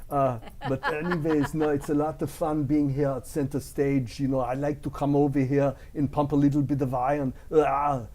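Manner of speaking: loud, hammed-up Austrian-accented action hero; crazy Arnold. Impressionist showing off for host, crazy action-star voice. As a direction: boom it out with grunting yells. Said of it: "that's kind of like the crazy Arnold voice".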